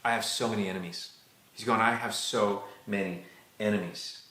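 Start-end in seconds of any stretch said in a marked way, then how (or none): 1.8-4.2 a subdued, heavy-hearted prayer; weary lament. Acted. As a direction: draw out SO MANY ENEMIES with pauses. David's old prayer repeated for effect.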